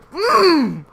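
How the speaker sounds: high, loud frustrated "mm"; hammed-up huff of rage; mock angry grunt. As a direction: imitate a fuming closed-mouth growl. Imitates customer's angry grunt.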